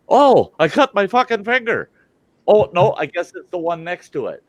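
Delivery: high, startled yelp of disbelief; retold panicked cry over a cut finger; shocked outburst. Retelling his shocked reaction.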